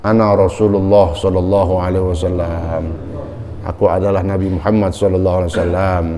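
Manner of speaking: low, measured solemn declaration; hushed, even dream-figure voice; Prophet Muhammad. Acted. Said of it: "Lalu berkata pemuda ini".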